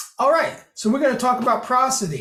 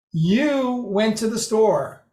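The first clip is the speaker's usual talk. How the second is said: You drawn out and raised, rest falls away; stress on YOU; slower than usual. Shown as a demonstration. Lecturer showing emphasis picks the person, stress on You. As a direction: lean slowly on the word You. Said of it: "The emphasis on the right word".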